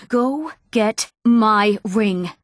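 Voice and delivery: slow, word-by-word order; stern demand. Acted. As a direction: space out every word as a firm command.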